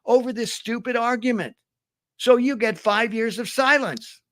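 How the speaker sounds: high, insistent telling-off; exasperated scolding. Acted. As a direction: hand down the sentence, fed up and emphatic. Lion scolds tiger while passing judgment.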